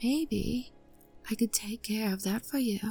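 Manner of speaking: breathy whisper, leaning in close; seductive whisper. Acted. Maid whispering a seductive offer to her master. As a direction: whisper the offer into his ear.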